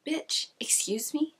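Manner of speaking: slow, high, breathy whisper of disbelief; half-whispered outraged comeback; mock indignant. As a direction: hiss it like you can't believe her nerve. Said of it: "So I was pretty pissed".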